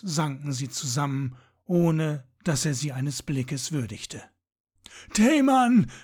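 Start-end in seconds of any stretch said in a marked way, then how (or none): 5.1-5.9 much higher and louder, shouting his name; calling out. A narrator's reading. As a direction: cry out to his wounded friend. Calls to his dying friend. (lauter, hoher Ruf)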